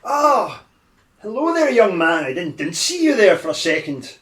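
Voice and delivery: put-on high-pitched, startled old gentleman; lost old man. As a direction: greet as a surprised, harmless old man. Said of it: "go into lost old man".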